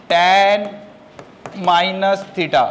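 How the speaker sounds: words set apart by a long pause; slow dictation. Shown as a demonstration. Teacher giving the third formula, slow dictation. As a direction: dictate piece by piece.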